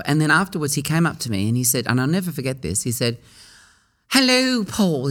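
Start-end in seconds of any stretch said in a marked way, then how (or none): 4.1-5.1 high, sing-out English greeting; strong English accent. Retold or imitated. English director greeting a young performer after the show, strong English accent. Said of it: "He's got a very strong accent".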